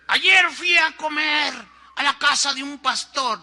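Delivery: preaching shout. Retold or imitated. Pastor's sermon retold by leader.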